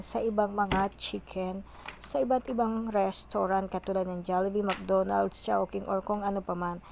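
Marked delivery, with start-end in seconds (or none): none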